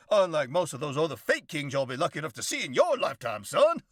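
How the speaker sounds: high, loud, pompous drawling windbag; blustery Southern gentleman. Acted. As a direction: lecture the boy like a self-important old colonel. Elrod introduces himself grandly.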